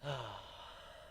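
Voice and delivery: soft, weary exhale; heavy resigned sigh; long sigh. Acted. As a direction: let a tired sigh fall out. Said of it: "then let out a sigh".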